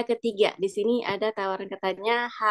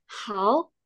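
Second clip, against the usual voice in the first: "How" with a rising end; second-tone demo. Shown as a demonstration. Teacher modelling tone two for repetition, rising "How". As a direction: swing "How" upward. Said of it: "kita coba dulu dengan nada kedua".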